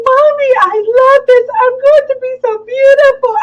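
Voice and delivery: put-on high, loud gushing kid; little girl voice. Mother imitating daughter loving her school clothes. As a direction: gush like a delighted little girl. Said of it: "She's like".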